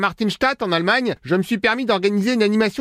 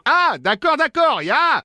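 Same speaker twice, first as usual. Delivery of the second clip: put-on hammy German accent, pitched higher; fake German accent; 6 semitones higher, much slower than usual. Prank caller posing as German brother-in-law, hammy German accent. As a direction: lay on a cheesy German accent in French. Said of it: "l'accent pourri".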